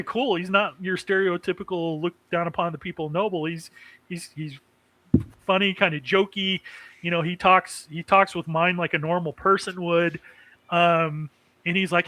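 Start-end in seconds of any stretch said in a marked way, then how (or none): none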